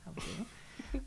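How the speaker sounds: breathy unvoiced whisper of I'm with you. Shown as a demonstration. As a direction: whisper the repeat softly. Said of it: "repeated whispered".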